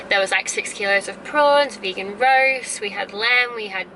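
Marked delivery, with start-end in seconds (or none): none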